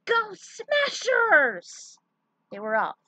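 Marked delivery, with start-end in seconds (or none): 0.1-2.0 high, loud, slow rallying yell; yelled cheer. A narrator's reading. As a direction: shout it out as a team battle cry. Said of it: "as they yelled".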